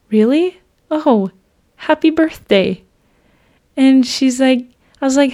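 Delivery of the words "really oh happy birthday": dumbfounded. Retold or imitated. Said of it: "I just sat there dumbfounded".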